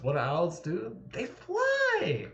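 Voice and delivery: high squeaky answer to own question; mock teacher quiz. Streamer quizzing chat in a silly voice. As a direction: quiz yourself like a kids' show host.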